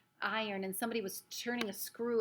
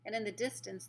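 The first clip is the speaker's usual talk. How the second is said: usual speaking voice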